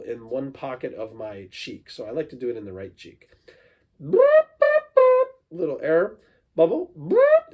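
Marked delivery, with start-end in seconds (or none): 4.0-7.5 very high, loud buzzing trumpet boops; mouth trumpet. Shown as a demonstration. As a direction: buzz the lips like a trumpet. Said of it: "it sounds like this".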